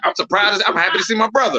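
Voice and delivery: usual speaking voice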